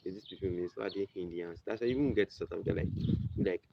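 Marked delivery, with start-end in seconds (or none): none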